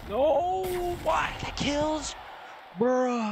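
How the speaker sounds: high, loud disbelieving shout; shocked yell. Real, not acted. Shocked outburst at a loss.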